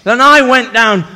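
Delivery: ordinary talk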